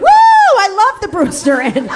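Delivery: high, loud gushing cheer about the restaurant; mock excited local. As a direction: ham up a thrilled townie raving. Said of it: "people are like".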